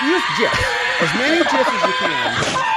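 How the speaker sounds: blurting it out high through a laugh; laughter breaking over the words. Real, not acted. Host cracking up while reading Paul's comment, laughing.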